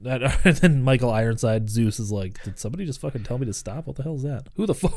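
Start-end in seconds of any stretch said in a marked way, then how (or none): 2.4-5.0 imitated villain incredulity. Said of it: "he didn't quite say it like that".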